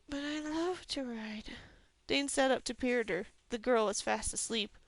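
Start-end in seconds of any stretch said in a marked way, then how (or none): 0.1-1.6 sleep-talking. A narrator's reading. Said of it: "said clearly".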